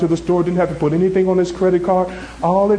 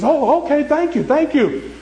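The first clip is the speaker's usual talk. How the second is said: mildly acted grateful relief, higher pitch; grateful friend; 6 semitones higher than usual. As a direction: play a thankful friend, voice lifting. Relieved friend accepts offer to pay.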